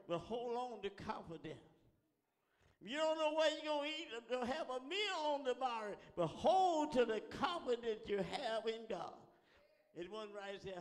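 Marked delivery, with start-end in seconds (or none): 2.8-9.2 high, loud, driving preaching; fervent exhortation. Real, not acted. Preacher urging hungry congregation to keep confidence, loud and driving. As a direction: build to a peak and urge them on.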